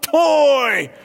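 very high, very loud, slow cartoon shout; shrieking cowboy toy yell; Woody yelling. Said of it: "Woody yells out".

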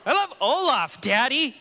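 put-on very high, loud little-girl voice; toddler voice. Pastor voicing his daughter declaring love for Olaf, high and loud. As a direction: squeal it like a delighted two-year-old.

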